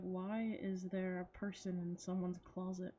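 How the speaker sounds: normal talking voice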